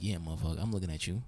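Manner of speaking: low, soft tough-guy challenge; mock menace. Staring back at her ex, mock menace. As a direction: keep it down, like a threat under your breath.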